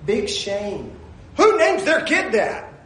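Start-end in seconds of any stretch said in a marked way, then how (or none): 1.4-2.7 high-pitched comic disbelief; incredulous. Real, not acted. Preacher reacting to an awful name, incredulous. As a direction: blurt it out high, in mock outrage.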